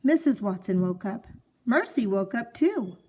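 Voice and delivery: ordinary delivery, nothing marked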